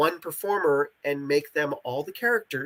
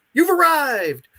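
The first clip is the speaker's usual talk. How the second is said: high, loud chirpy system prompt; game voice announcement; 12 semitones higher, 7 dB louder, slower than usual. Retold or imitated. Quoting what the game says on arrival, chirpy system voice. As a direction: announce it like a cheerful app notification. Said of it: "it says".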